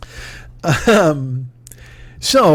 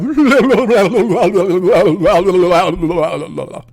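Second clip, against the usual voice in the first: long helpless laugh; real laughter. Real, not acted. Laughing at own singing.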